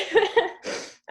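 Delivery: embarrassed laugh through tears; teary laugh. Real, not acted. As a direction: laugh it off while crying. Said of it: "sobbing on here".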